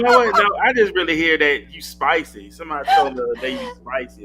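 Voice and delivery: loud, high, laughing tease; excited teasing. Real, not acted. Cohost teasing the guest that she is spicy, loud and high. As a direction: hype her up as spicy.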